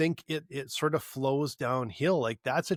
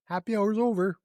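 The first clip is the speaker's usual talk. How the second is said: high, louder singsong mock of the waitress; 7 semitones higher, 4 dB louder than usual. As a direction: parrot her line back in a higher, louder voice. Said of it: "It's just like".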